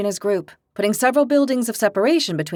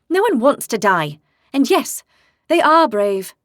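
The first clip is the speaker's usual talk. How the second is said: louder, firm reproach; reproachful; 4 semitones higher, 5 dB louder than usual. A narrator's reading. Nielsa rebuking Vigo for calling soldiers foolish, louder and reproachful. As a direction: scold him for saying they want to die. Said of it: "in a reproachful tone".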